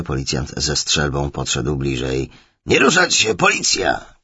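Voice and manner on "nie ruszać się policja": loud, high police shout; shouted command. Acted. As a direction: bark it out like a cop storming in. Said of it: "krzyknął". (głośny, wysoki okrzyk policjanta)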